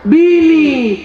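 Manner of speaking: loud, high, drawn-out word; high comic holler; emphatic call-out. Real, not acted. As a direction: belt out the punchline word. Punchline translation of verse, called out.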